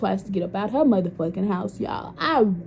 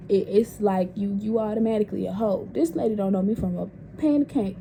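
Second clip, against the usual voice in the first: ordinary talk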